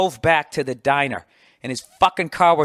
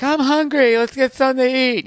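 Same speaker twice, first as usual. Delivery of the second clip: much higher, lightly voiced girlfriend; girlfriend's voice; 10 semitones higher, 5 dB louder than usual. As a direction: play the girlfriend asking to eat. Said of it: "My girl's like".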